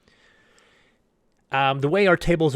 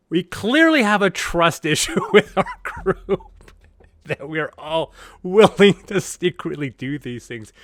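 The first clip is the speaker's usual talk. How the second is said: laughing quote; 4 semitones higher, 6 dB louder than usual. Retold or imitated. Players realize they all betrayed.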